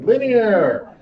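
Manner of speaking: high, booming echo of the answer; loud call-out. Real, not acted. Teacher loudly echoing a student's answer, loud call-out.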